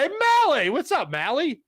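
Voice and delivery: sudden high happy hello; excited greeting. Real, not acted. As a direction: squeal the name high and loud. Excited greeting to a chat viewer.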